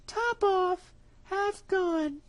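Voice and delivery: a trusting mouse sending him off; little mouse. Acted. Mouse muses.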